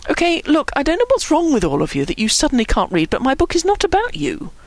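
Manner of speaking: high, exasperated explaining; exasperated would-be patience; strained patience. A narrator's reading. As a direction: spell it out, patience wearing thin. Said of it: "with what he felt was almost superhuman patience".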